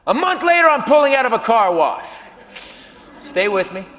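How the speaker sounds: usual speaking voice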